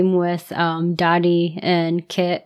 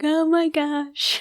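much higher, softer and slow; moved hush; 8 semitones higher, 6 dB quieter, slower than usual. Retold or imitated. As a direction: let it out softly, overcome. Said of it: "I'm like".

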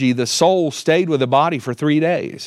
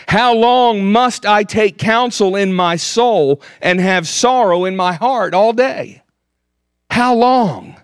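anguished cry; 6 semitones higher than usual. Acted. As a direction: cry it out like the psalmist pleading. Psalmist crying out.

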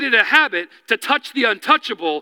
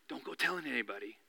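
much softer, low and quick; hushed; 10 semitones lower, 19 dB quieter, faster than usual. Retold or imitated. Jesus to the healed man, hushed and low. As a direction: tell him to keep it quiet.

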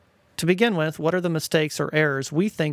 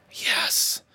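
thunderous whisper. Retold or imitated. A little boy agrees at bedtime in a thunderous whisper. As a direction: whisper it hard, like it's meant to carry. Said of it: "he agreed with a thunderous whisper".

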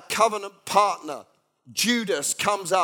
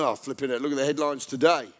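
low, soft grumbling mimicry; moaner and complainer; 8 semitones lower, 5 dB quieter than usual. Office moaner complaining over the morning headlines, grumbling. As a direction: mumble a gloomy complaint under the breath. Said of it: "the moaner and the complainer".

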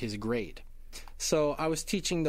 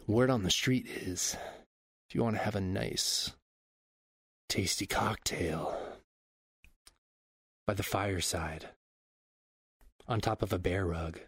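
mock-seductive intro; 5 semitones lower than usual. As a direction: draw it out with long suggestive pauses. Performs a seductive guest introduction.

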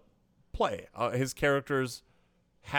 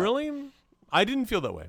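higher-pitched quick rebuttal; surprised disagreement; 10 semitones higher than usual. Real, not acted. Co-host answering criticism of an actor's gravitas, surprised disagreement. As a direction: push back, lifting the pitch on "didn't". First take of disagreement, then restated.

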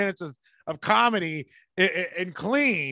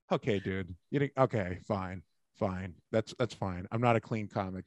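low, quiet retold grumble; deflated aside, voice dropped right down; resigned acceptance; 11 semitones lower, 9 dB quieter than usual. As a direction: give in under your breath, much lower and softer. Retelling his deflated reply to colleague.

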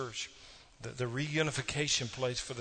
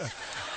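breathy laugh; 4 dB quieter than usual. Real, not acted. Laughing at his own joke.

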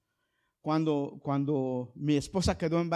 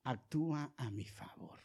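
hushed prayer; 3 semitones lower, 7 dB quieter, slower than usual. Retold or imitated. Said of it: "cuando le decimos".

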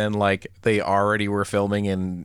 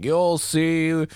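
higher, drawn-out warning; horror-movie doomsayer; 6 semitones higher, slower than usual. Acted. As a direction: play the ominous local warning off the visitors. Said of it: "one of the characters goes like".

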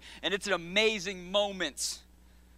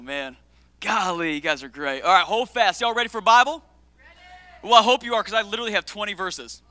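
ordinary talk, nothing marked

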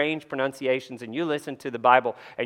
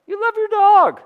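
teasing high voice; 6 dB louder than usual. Acted. Teasing Rob in congregation.

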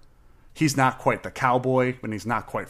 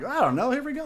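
high, singsong self-imitation; mock carefree reply; 14 semitones higher than usual. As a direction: play your clueless past self, pitched way up. Acting out past self taking quiz.

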